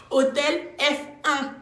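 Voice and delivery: Hôtel F un, drawn out slowly and pitched high; outraged. Retold or imitated. Outraged repeat of hotel name.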